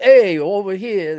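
exaggerated Chicago street accent. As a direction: call it out in broad, slow Chicago. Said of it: "there is a lot of, like, Chicago".

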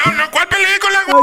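very high-pitched protest; indignant denial. Acted. Caller insisting it is no film, indignant. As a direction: object hotly, voice shooting up. Denies his story is a film.

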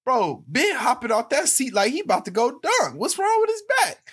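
high, loud mocking voice; mocking fan voice. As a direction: squeal it like a heckling fan. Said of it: "they was like".

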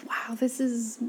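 breathy whispered wow, half to herself; hushed whisper of amazement; awed whisper. Retold or imitated. Inner thought, moment of awe.

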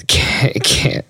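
usual speaking voice

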